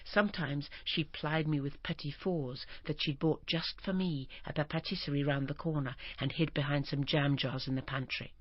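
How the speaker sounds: usual speaking voice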